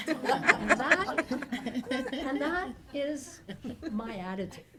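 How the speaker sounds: laughing as she delivers the punchline; a real laugh breaking up the words; laughing through it. Real, not acted. Speaker to audience after the joke, laughing.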